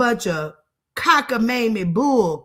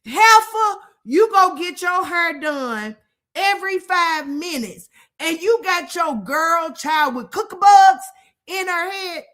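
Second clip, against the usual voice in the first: higher, louder scolding of a neglectful mother; scolding yell; 5 semitones higher, 4 dB louder than usual. Retold or imitated. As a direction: tell her off, loud and high. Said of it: "I said".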